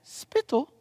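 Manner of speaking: mock English accent, lifted high. Shown as a demonstration. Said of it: "say it in an English accent".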